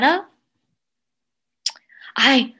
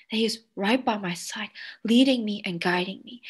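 normal talking voice

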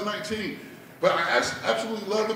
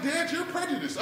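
high, softer daughter voice; accusing daughter; 8 semitones higher, 5 dB quieter than usual. Retold or imitated. Daughter accusing dad of prejudice, reproachful. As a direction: pitch it up in reproach.

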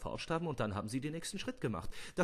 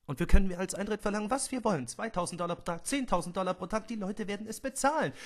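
higher, faster, eager salesman pitch; excited pitch; 9 semitones higher, 6 dB louder than usual. Acted. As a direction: sell it with growing greed. Hammond schwärmt vom Profit. (begeistert, höher und schneller)

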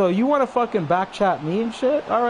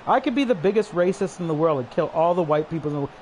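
ordinary delivery

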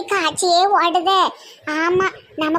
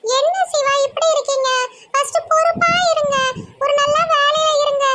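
put-on much higher-pitched girlish character voice; earnest young woman; 11 semitones higher than usual. Munis urging Siva to get a good job, high-voiced and earnest. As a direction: advise him in a high, even voice.

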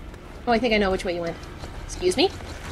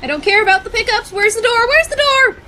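panicked; 10 semitones higher, 4 dB louder than usual. Real, not acted. Fleeing monsters, looking for door.

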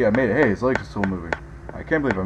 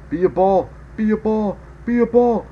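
rising pleading; 8 semitones higher than usual. Real, not acted.

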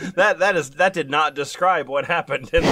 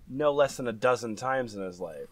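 ordinary talk

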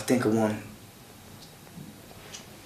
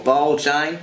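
higher-pitched Aussie cricket call; imitated Aussie wicketkeeper, pitched up; Australian accent; 4 semitones higher, slower than usual. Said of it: "my little Aussie prep".

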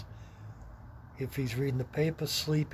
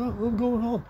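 mock dismissive; 9 semitones higher, 4 dB louder, slower than usual.

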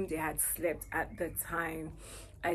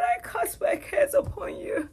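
whispered prayer; 5 dB louder than usual. Retold or imitated. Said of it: "started saying".

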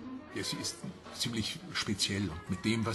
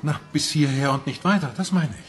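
loud, higher-pitched retort; emphatic clarification; 3 semitones higher, 9 dB louder than usual. Acted. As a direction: spell out the limit forcefully. Tillmann erklärt seine Grenze. (laut und nachdrücklich)

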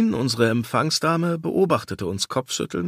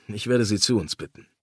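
plain reading voice

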